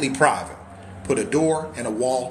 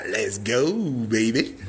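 ordinary delivery

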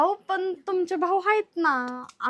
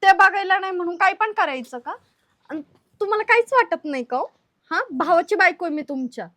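higher, louder indignant scolding; irritated; 3 semitones higher, 4 dB louder than usual. Acted. Brother's wife confronting brother-in-law, irritated. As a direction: take him to task, voice raised. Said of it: "तुम्ही चिडू नका बरं".

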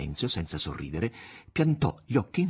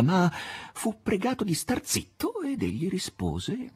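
ordinary delivery

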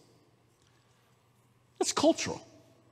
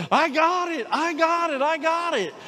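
high, overcome imitation of crying; retold tearful cry; 7 semitones higher than usual. Friend comes to the preacher after laying hands, imitated tearful cry. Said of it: "He's crying".

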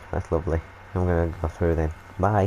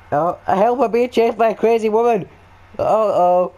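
mock panic; 12 semitones higher, 5 dB louder than usual. Fleeing a woman, mock panic.

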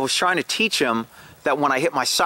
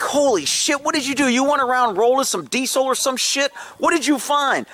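much higher, alarmed at a stench; reacting to a sudden awful smell; shocked; 10 semitones higher than usual. Smelling fresh skunk on the dog, shocked outburst.

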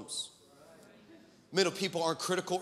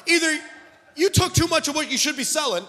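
high, loud, slow pulpit declaration; forceful preaching, stretched phrase endings; impassioned preaching; 9 semitones higher, 6 dB louder than usual. Real, not acted. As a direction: proclaim it to the congregation.

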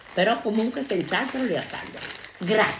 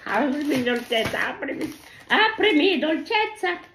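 playful coaxing; 6 semitones higher than usual. Real, not acted. Coaxing a gift open.

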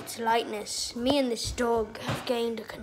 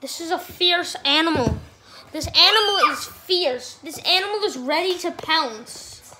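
nature documentary; 3 semitones higher, 4 dB louder than usual. Pretend. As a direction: announce the fierce animal. Loud nature show.

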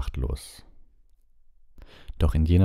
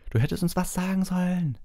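lightly voiced Fred, half cross, half impressed; a hint of a grumbling twin; half-annoyed yell. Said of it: "brüllte Fred halb verärgert".

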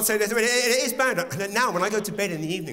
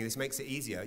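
ordinary talk

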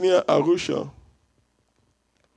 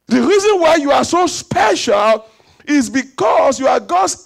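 higher, louder, fervent preaching; full-force preaching; 6 semitones higher, 4 dB louder than usual. Acted. Pastor preaching to the congregation about being God's image, fervent shout. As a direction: lift the room with a fervent cry.

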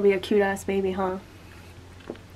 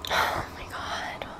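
whispering; 13 dB quieter than usual. Real, not acted. Prank about to unravel.

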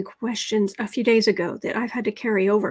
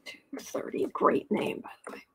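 hushed whispered aside; breathy whisper under the breath; 10 dB quieter than usual. Real, not acted. Whispered aside during reading.

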